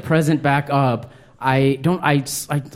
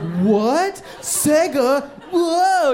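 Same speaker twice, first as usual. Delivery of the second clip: hammed-up, much higher shocked delight; fake surprise; 11 semitones higher, much slower than usual. Kid faking joy over the Sega for his dad, hammy surprise. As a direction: overact the surprise like a bad actor. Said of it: "Like a bad actor".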